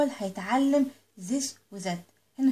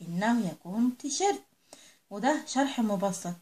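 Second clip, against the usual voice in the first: normal talking voice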